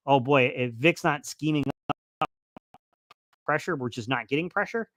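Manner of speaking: ordinary talk, nothing marked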